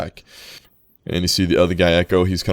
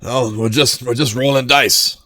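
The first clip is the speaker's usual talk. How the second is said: gambling worker; 6 semitones higher, 6 dB louder than usual. Acted.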